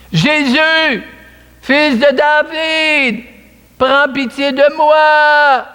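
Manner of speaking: high, slow cry for mercy. A narrator's reading. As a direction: call out to Jesus from the roadside, drawn out. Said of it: "il se met à crier". (cri lent et aigu d'un aveugle qui implore Jésus)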